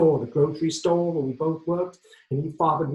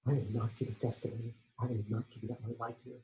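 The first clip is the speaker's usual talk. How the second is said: quiet refusal; 3 semitones lower, 12 dB quieter, faster than usual. Retold or imitated. Quiet resolve after outburst.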